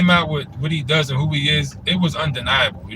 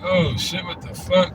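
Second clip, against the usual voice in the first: shocked reaction; 5 semitones higher, slower than usual. Retold or imitated. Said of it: "I was like".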